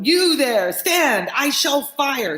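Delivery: high, commanding shout; guard shouting. Acted. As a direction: bark a warning, slowing at the end. Guard threatens rider.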